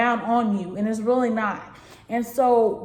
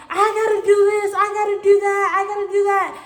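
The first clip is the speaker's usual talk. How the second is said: frantic; 8 semitones higher, 3 dB louder, faster than usual. Retold or imitated. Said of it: "and I was like".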